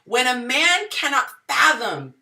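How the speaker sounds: ordinary talk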